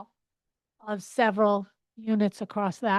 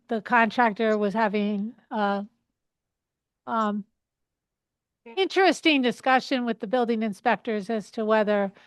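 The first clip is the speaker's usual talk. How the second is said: louder, leaning into the mic; speaking up; 8 dB louder than usual. Real, not acted. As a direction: raise your voice so the room hears. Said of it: "Madam Chair, I can't hear a word".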